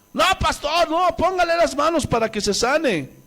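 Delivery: high-pitched mock pleading; protesting relatives. Relatives beg pastor to lay on hands, imitated high protest. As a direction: beg insistently, imitating anxious family. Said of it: "dicen los familiares".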